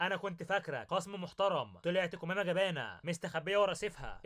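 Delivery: ordinary delivery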